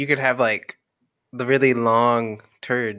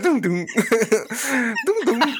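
leaving sound effect; 11 semitones higher, much slower than usual. Retold or imitated. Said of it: "That's the sound of you leaving".

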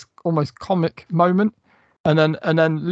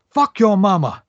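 mock-villain retort, higher and louder; Captain Spaulding's comeback; 6 semitones higher, 6 dB louder than usual. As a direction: spit it back like the sneering killer clown. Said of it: "And he's just like".

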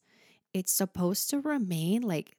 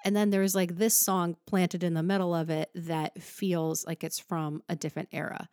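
normal talking voice

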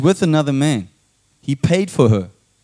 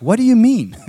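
confused men; 8 semitones higher, 5 dB louder than usual. Retold or imitated. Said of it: "the men are like".

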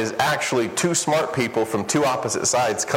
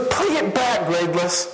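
pitched high, "Play" hit hard, pushy; a buddy demanding he replay it; exasperated friend; 6 semitones higher than usual. Retold or imitated. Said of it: "He goes".

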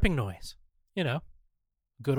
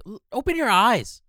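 exasperated; 8 semitones higher, 7 dB louder than usual. Acted. Exasperated at closed eyes.

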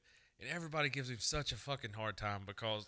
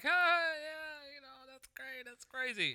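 awkward reply; 14 semitones higher, slower than usual. Retold or imitated. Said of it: "and you're like".

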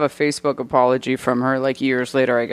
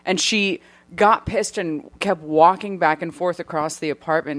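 usual speaking voice

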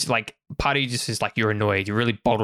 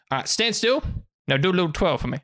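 high-pitched southern tailor; southern accent; 5 semitones higher, faster than usual. Acted. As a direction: instruct a customer in a Southern lilt.

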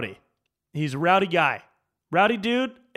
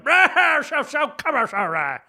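high, loud slurred babble, mimicking the heckler; hammed-up nonsense holler of a rowdy drunk; drunk gibberish yell; 10 semitones higher, 6 dB louder than usual. Mimicking the rowdy guy at the show with a loud gibberish holler. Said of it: "It was more like a".

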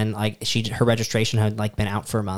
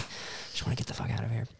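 voiceless, very soft aside; muttered under breath; 15 dB quieter than usual. Retold or imitated. Driver at the traffic stop, whispering to herself. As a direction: say it to yourself so he can't hear. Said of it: "I was like".

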